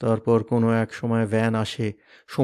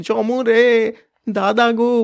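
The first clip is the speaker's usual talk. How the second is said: high, loud crying call; anguished keen; keening wail; 9 semitones higher, 6 dB louder, much slower than usual. Acted. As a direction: sisters wailing for a brother. Sisters wailing.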